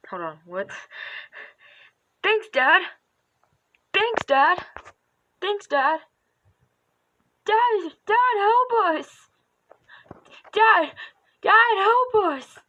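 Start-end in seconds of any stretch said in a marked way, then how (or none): 2.2-6.0 high, bright cartoon kid; chirpy child thank-you; kid character voice. Practicing character voice lines. 7.5-12.6 high, loud frantic plea; panicked kid cry. Pretend. Voice acting a cry for help.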